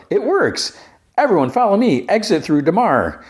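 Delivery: high, acted-out triumphant announcement; excited rallying call. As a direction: imitate someone eagerly rallying a crowd. Acting Josh Gad rallying the crowd.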